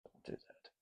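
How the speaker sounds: barely voiced under the breath; whispered. Real, not acted. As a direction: whisper it to yourself. Muttering to himself while handling the fan.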